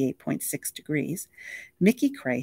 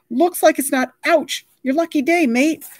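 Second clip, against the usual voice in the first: loud, much higher, cocky Aussie showman cut by pain; TV adventurer bravado; 12 semitones higher, 7 dB louder than usual. Acted. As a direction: force the brave catchphrase through the bites. TV host bravado while being bitten.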